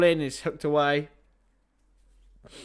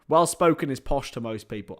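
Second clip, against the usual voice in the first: ordinary delivery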